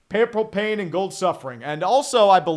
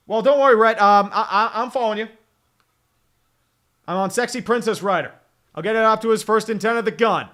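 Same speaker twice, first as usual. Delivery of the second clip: usual speaking voice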